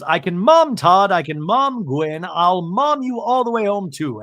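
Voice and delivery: hammed-up eager mom impression; Bronwyn. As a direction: offer help in a stretched-out, lilting voice. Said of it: "Bronwyn goes".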